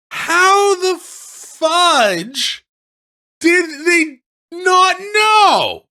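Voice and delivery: high, slow, shouted disbelief; exasperated shout at the game; incredulous outburst. Real, not acted. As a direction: yell it stretched out in disbelief. Outraged at the game's logic.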